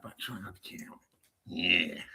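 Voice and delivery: soft unvoiced whisper. Real, not acted. Host whispering about the dog showing up on camera. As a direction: breathe it as a hushed aside.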